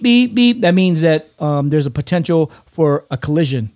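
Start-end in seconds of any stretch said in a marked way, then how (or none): 0.0-0.6 higher, clipped beep, beep; imitated alarm beep. Shown as a demonstration. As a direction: mimic a cockpit alarm. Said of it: "when it goes beep".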